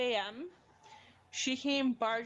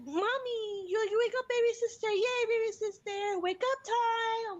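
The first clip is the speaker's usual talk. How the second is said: put-on young daughter; 12 semitones higher, 4 dB louder than usual. Daughter cheering baby sister awake, high excited child imitation. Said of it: "She's like".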